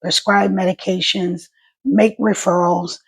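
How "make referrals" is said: ordinary delivery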